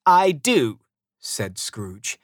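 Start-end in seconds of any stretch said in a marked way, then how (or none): 0.1-0.8 higher, louder curt reply; grumpy retort. A narrator's reading. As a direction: answer back as a cross miser. Scrooge snaps back at nephew.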